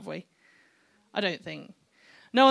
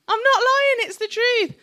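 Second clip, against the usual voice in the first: high, loud protest of honesty; loud insisting; 13 semitones higher, 8 dB louder than usual. Real, not acted. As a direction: raise your voice and insist it's true. Loudly insists to congregation.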